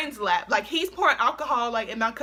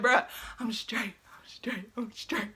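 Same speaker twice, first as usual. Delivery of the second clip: softer, slow, fading to a whisper; reassure, trailing off into a whisper; fading whispered insistence; 2 semitones lower, 7 dB quieter than usual. Retold or imitated.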